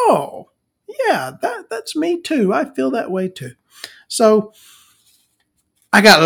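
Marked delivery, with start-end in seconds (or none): 0.1-3.5 high, breathy mock agreement; mock gushing listener. Mimicking a fan agreeing, high and gushing. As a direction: gush along in a high voice.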